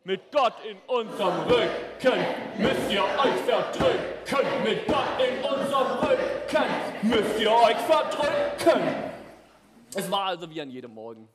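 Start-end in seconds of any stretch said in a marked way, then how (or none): 0.0-9.1 lauter, langsam skandierter Reim; brüllt den Reim wie ein Heer zurück; zurückgebrüllter Kampfreim. Acted. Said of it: "Wir haben zurückgebrüllt". (Israeliten brüllen den Philistern ihren Reim entgegen, laut skandiert)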